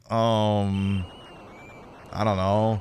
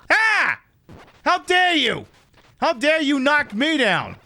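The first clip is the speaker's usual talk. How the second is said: hammed-up indignant cry, much higher; mock outrage; 11 semitones higher, slower than usual. Gamer fakes indignation at an on-screen hit, in mock outrage. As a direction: play it as a pompous huff after the fall.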